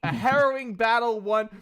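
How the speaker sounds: dramatic recap. Acted. GM reads his own dramatic notes.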